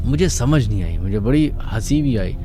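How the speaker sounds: ordinary talk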